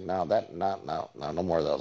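low, softening refusal; lightly voiced low, reluctant string of noes; Mike Myers. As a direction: play a retired star waving it away. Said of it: "he's like".

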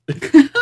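high loud laugh bursting out; burst of laughter. Real, not acted. Laughing at the Rock's joke, loud laugh. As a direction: crack up at the memory.

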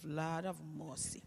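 very soft, low, slow dread; hushed, slow aside to oneself. Acted. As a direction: sigh it privately under your breath. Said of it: "I say to myself".

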